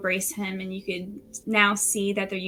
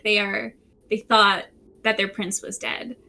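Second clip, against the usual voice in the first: ordinary talk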